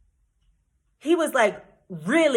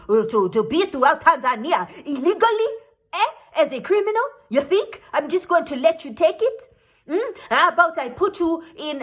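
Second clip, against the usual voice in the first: a touch louder and higher, threatening; mock angry official; 5 semitones higher, 4 dB louder than usual. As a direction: lean in like a bullying officer. Officer threatening in retold story.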